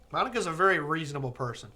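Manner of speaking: normal talking voice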